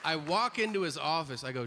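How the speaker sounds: ordinary talk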